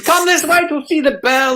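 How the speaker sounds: high, mock guide call; a hint of a keen guide; tour guide beckoning. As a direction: usher them along to the bell. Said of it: "and it's".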